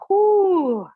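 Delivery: loud high whoop on rising to standing; whoo of effort. Real, not acted. Coming up to standing.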